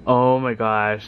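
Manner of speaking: ordinary talk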